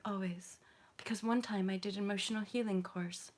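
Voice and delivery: breezy, hushed send-up of a serene teacher, a bit fast; mock yoga teacher. Yoga teacher mocking the calm-voice cliché, in a hushed breezy parody. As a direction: parody a too-calm spiritual guru, lightly. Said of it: "I'm supposed to talk like this".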